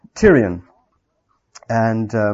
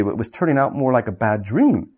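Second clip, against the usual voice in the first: usual speaking voice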